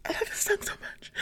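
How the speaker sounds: high breathless gush over a song; gushing excitedly. Retold or imitated. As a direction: squeal it like a starstruck fan. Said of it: "and I was like".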